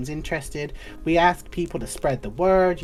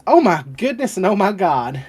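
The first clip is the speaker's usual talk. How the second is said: louder, flustered clerk voice; startled receptionist; 6 semitones higher, 6 dB louder than usual. Acted. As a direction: jump as if startled by the door. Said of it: "Flo goes".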